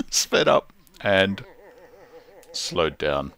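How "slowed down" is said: ordinary talk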